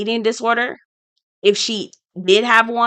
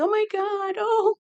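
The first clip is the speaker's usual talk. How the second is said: mock crying; 12 semitones higher, 8 dB quieter than usual. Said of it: "boohoo crying".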